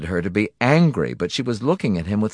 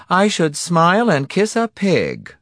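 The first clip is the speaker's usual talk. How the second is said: higher, slower wife voice; wife's voice; 11 semitones higher, 4 dB louder than usual. A narrator's reading. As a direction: quote the wife's saying, higher. Narrator mimics wife's saying.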